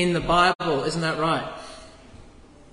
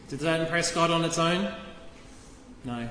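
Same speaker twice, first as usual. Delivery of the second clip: usual speaking voice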